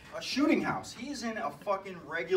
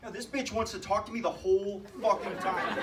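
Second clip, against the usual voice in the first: normal talking voice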